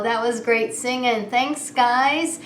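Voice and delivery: ordinary talk, nothing marked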